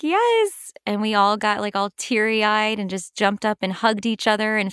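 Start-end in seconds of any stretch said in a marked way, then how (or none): 0.0-0.7 high, loud celebratory yes; excited yes. Retold or imitated. Producers telling young cast they got the part, excited yes. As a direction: imitate thrilled confirmation, pitched way up.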